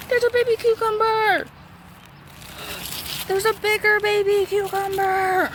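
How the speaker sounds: high squeaky cooing voice. Real, not acted. Gardener cooing at tiny cucumbers, squeaky high voice. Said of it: "my squeaky voice".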